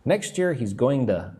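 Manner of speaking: going to linked as "going-duh"; reduced "to". Shown as a demonstration. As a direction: end on to said as "duh". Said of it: "turn to into duh".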